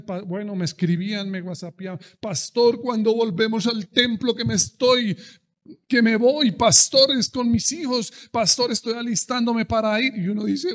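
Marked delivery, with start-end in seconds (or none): 2.2-10.1 higher, louder pleading voice of a church member; eager congregant. Retold or imitated. Church members begging pastor to reopen temple, loud pleading. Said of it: "los hermanos me llamaban y me decían".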